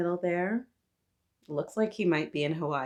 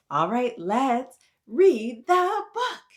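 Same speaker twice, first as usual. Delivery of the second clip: slow, louder, drawn-out call; excited announcement; 6 semitones higher, 7 dB louder, slower than usual. Acted. Host telling little readers the book begins, excited and slow. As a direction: announce the big moment grandly.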